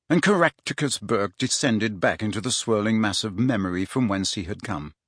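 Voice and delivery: plain reading voice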